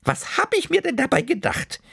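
hohe Omastimme, langsam und gerührt; liebevolle Oma. Acted. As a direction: sag es, während du das Kind fest umarmst. Oma schämt sich ein wenig. (Oma, langsam und zärtlich)

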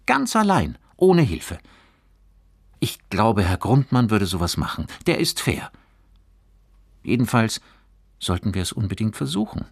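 put-on high, loud, keen boy's voice; earnest persuading boy. A narrator's reading. Johannes vouches for the teacher's fairness, earnestly persuading. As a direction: urge your friend to try.

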